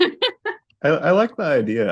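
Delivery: laughing through the first words; giggling as she agrees, then settling. Real, not acted. Co-host laughing as she warms to the idea.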